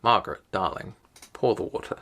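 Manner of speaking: soft, low non-rhotic London accent; non-rhotic London accent, every r dropped; natural non-rhotic accent. Shown as a demonstration. Showing own accent to viewers, non-rhotic London. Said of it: "My natural accent".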